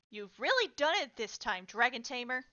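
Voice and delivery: loud, angry telling-off; furious boss's accusation. A narrator's reading. Angry boss confronts soldier, slams desk.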